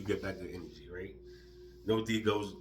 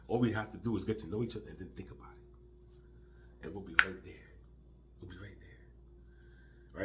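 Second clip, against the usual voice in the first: hushed whisper; 3 semitones lower, 7 dB quieter than usual. Real, not acted. Mystic talk of shared mind.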